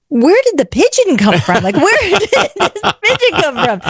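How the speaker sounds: baffled giggle at the pigeon; thinking aloud about the pigeon, amused; laughing disbelief. Retold or imitated. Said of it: "I'm thinking".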